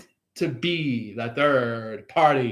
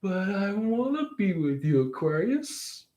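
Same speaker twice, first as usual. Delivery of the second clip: higher, soft whiny plea; pleading third party; 6 semitones higher, 5 dB quieter than usual. Retold or imitated. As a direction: beg softly as they walk away. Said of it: "they're like".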